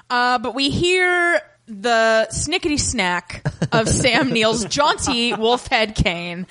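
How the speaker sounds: normal talking voice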